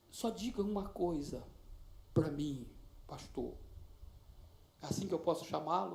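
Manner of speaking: put-on soft, hesitant young girl's voice; timid girl. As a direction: ask him gently, almost afraid. Shy teenage girl addressing pastor.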